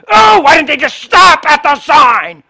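high, loud, slow whine; mock whiny complainer. Preacher mocking a complaining driver, high loud whine.

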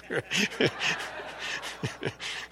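a breathy chuckle at his own joke; soft chuckle. Real, not acted. Preacher laughing at his own LSU joke, soft chuckle. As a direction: laugh quietly to yourself.

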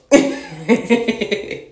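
breathy rising giggle; airy high laugh. Real, not acted. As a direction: laugh it off, amused. Laughs at knee cracking story.

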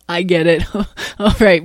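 getting the joke at last, amused; laughing. Acted. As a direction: chuckle through the words.

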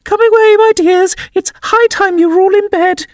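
very high, trembling bird call. A narrator's reading. Canary calls its children away, trembling and shrill. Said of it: "called out in a trembling voice to its children".

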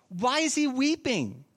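ordinary talk, nothing marked

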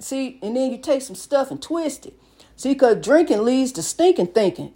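normal talking voice

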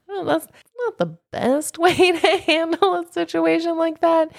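high, lightly mocking disapproval; prim scolding wife. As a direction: lecture him like a let-down wife. Fiona voiced in the retelling.

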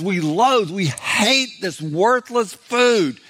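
drawn-out, emphatic mock disgust; slow, heavily leaned-on complaint; griping. Preacher voicing Israelites hating the worthless food, griping. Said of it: "they're just griping and complaining".

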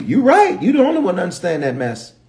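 high-pitched sarcastic agreement; mocking retort. Answering someone who claims to understand him, mocking retort. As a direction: throw it back, quickening in exasperation.

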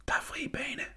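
breathy stage whisper, all air; a boy whispering that the way is clear. Acted. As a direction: whisper it so nobody beyond the door hears. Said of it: "hviskede han".

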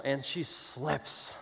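usual speaking voice

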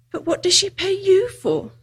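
put-on high, curious girl's question; lightly voiced girl, pitch lifted; Lucy. A narrator's reading.

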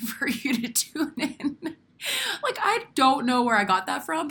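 real giggle breaking through the words; amused at your own slip, laughing as you talk. Real, not acted. Host owning up to listeners that she made it up, laughing.

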